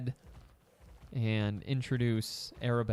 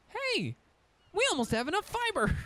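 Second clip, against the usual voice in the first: squeaky voice. Acted. Sudden squeaky playful voice.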